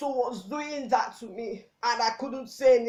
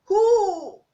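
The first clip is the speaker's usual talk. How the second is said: loud, high, anguished outburst; anguished cry; 7 semitones higher, 8 dB louder than usual. Acted. Child with nobody to talk to, loud anguished cry. Same anguished question repeated.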